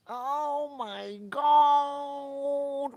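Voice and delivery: very slow, high, loud oh my God; drawn-out shriek. Real, not acted. Streamer shrieking at the game, very slow and loud. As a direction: stretch it out in shock. Reacting to crash on screen.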